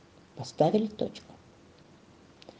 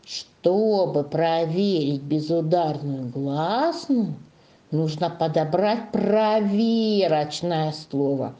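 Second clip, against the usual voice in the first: slow, measured recitation for the class to join; slow teacher's chant-along delivery; reciting a rule; 3 semitones lower, slower than usual. Shown as a demonstration. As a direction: lead the class through the rule, stretching key words. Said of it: "А теперь правило. Вместе со мной повторяем".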